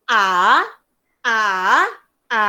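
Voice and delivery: A swept up like a question; second tone. Shown as a demonstration. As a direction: let A loose upward. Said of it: "Yang kedua menaik".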